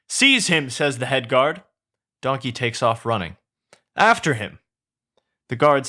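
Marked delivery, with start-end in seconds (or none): 0.1-0.7 high, raised-voice command; shouted order. Acted. Head guard orders pursuit. 4.0-4.6 high, raised-voice order; chase command. Acted. Guards give chase.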